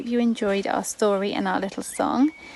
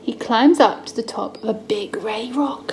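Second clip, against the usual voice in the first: storytelling whisper; 3 semitones higher, 3 dB louder than usual. Acted. Mum reading Bible story to son, hushed whisper. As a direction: draw the child in with a secret hush.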